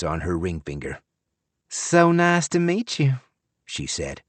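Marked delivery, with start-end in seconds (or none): 1.7-3.2 put-on high, sugary Southern belle accent; syrupy Southern accent. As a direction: greet him like a Southern belle, all syrup. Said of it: "with a syrupy accent that was straight out of Gone with the Wind".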